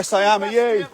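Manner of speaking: high sing-song voice for the dog; dog talk. Real, not acted. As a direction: coo at the dog like a pet owner. Talking to his dog.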